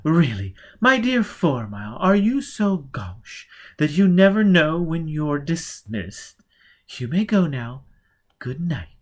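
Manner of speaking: lady scolding his gauche manners; haughty dismissal. Acted. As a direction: dismiss him like a servant.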